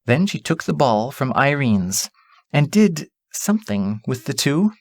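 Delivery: ordinary delivery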